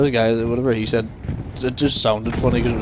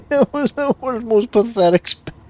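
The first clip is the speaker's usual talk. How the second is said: laughing; 9 semitones higher, 4 dB louder than usual. Real, not acted. Mocking a failed play, laughing.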